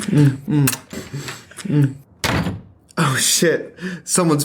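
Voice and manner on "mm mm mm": kissing murmurs. Acted. Kissing sounds.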